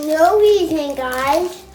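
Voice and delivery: talking through a mouthful of food; words around a bite of cookie; mouth full. Real, not acted. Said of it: "Don't talk with food in your mouth".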